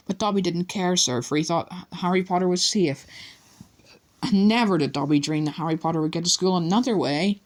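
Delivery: high, squeaky pleading elf; distressed house elf. A narrator's reading. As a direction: plead with Harry anxiously. Dobby voice.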